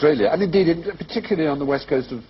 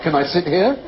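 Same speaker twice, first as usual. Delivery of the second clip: high, HERE rising sharply; upspeak; 7 semitones higher than usual. Acted. Retelling what he was like, mock upspeak. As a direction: imitate youngsters, lifting "here" at the end. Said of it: "he was like".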